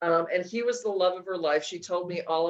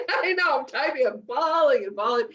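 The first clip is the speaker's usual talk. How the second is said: high, laughing, a bit teary; laughing real; 6 semitones higher, 4 dB louder than usual. Real, not acted. Telling the host she cried while typing, real laughter. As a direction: laugh through the memory of crying. Laughing at her own crying.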